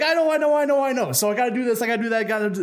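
high, hurried whiny excuses; sheepish excuses. Acted. Ivan making excuses to the wolf, high and whiny.

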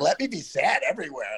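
high, hammed-up plea to be sad; mock dramatic plea. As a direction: beg to emote, playing it up. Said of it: "and I was like".

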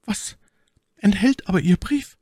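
anxious maid. A narrator's reading. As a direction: voice Cathy, high and worried. Cathy fragt ängstlich nach Brief. (hohe, besorgte Stimme der Zofe)

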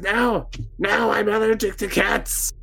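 high-pitched, wailing hag; hag in protest. Acted. Game master voices the pounced-on hag allergic to cats, high wailing protest. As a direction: wail it high in the hag's voice. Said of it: "and she goes".

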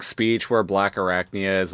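ordinary talk, nothing marked

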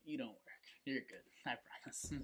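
soft, understated mock kidnapper; hushed put-on reassurance. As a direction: let them down quietly, as the kidnapper. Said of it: "he looks at you like".

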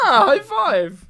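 loud, high and slow; excited high five. Real, not acted. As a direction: offer the high five eagerly.

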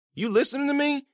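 high-pitched, pressing question; insistent question. Acted. Student presses silent Billy for a response, insistent question. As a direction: push for a response, pitch jumping up.

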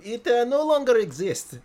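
high, loud mock Eastern European accent; Crazy Vaclav accent. Fan quoting Crazy Vaclav selling Homer a car, thick mock accent. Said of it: "That is a pretty good accent".

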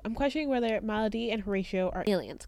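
ordinary delivery

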